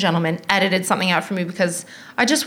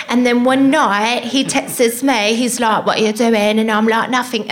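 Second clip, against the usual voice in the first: put-on London girl accent; 2 semitones higher than usual. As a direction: imitate a chatty London girl, babes and all. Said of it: "that's how she talked".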